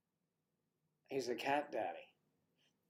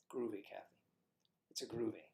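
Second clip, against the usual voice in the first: whisper; 6 dB quieter, faster than usual. Pretend. Playful aside.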